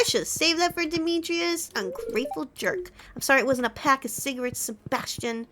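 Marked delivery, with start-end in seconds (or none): none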